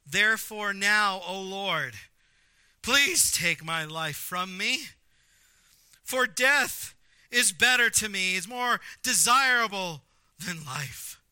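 slow, loud theatrical prayer of despair; melodramatic, drawn-out scripture reading; dramatic plea. Acted. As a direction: declaim it like a fed-up prophet begging God.

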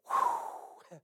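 sudden high, loud wrestler's whoo with a laugh; a whoop that bursts out at the top of the voice; Ric Flair whoo. Real, not acted. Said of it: "the old Ric Flair moment there".

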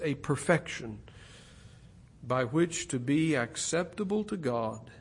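usual speaking voice

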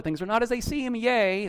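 mock childlike delight, voice lifted high; lightly played sunny kid cheering yay. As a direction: chirp it like a wide-eyed kid. Voicing a child's sunny optimism.

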